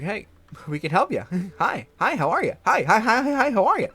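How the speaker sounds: overly friendly greeting. Retold or imitated. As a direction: pester them with sugary hellos. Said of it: "we were just like".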